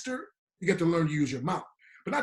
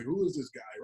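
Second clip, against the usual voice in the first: puzzled onlookers; 7 semitones lower, 6 dB quieter than usual. Retold or imitated. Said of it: "People on the highway are probably looking like".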